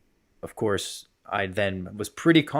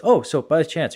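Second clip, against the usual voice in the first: casual afterthought; 7 semitones higher, 6 dB louder than usual. Retold or imitated. Acts coach springing a question.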